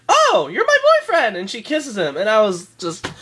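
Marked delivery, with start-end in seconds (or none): 0.1-1.4 high, loud, gushing squeal; mock swooning girlfriend, high and loud. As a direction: gush over him like a sudden fan. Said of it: "Ginger's like".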